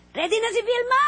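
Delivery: high, loud crew call; calling out on set. Retold or imitated. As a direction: shout it across the set.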